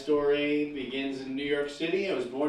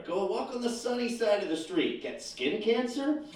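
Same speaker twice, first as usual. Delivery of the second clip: sarcastic; 6 semitones higher than usual. Acted. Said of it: "terms about the weather".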